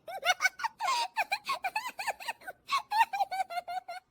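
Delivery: long high-pitched peal of laughter; helpless high giggling; loud laughter. Acted. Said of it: "जोर जोर से हंसने की आवाज".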